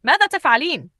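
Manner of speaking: high, loud angry question; angry shout. A narrator's reading. Husband catching his wife feeding a beggar, shouting. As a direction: shout it in outrage. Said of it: "صرخ".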